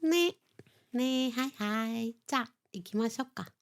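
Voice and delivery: put-on cutesy kawaii shop girl voice; sweet high anime girl voice; breathy cutesy giggly. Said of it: "He cambiado la voz ahí".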